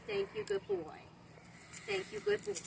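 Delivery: high squeaky praise for a puppy; squeaky praising a dog. Real, not acted.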